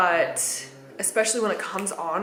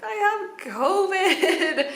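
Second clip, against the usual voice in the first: laughing; 11 semitones higher, much slower than usual. Retold or imitated. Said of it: "And I was like".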